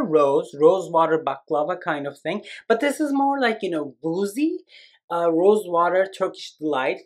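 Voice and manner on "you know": ordinary talk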